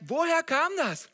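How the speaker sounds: high, loud, drawn-out question; acted astonishment, pitched right up; baffled disbelief. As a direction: demand it of yourself, astonished. Acts out baffled self-questioning.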